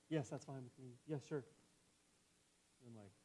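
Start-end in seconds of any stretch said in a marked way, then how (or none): 0.1-1.5 loud, impatient yes to a spouse; curt distracted reply. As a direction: answer too loudly, brushing off the interruption. Snaps back at wife while fixated.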